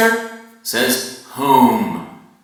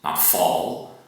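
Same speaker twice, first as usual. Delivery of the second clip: plain reading voice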